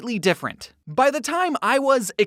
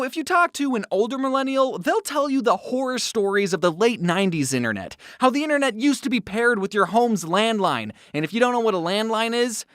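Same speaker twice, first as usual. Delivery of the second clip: usual speaking voice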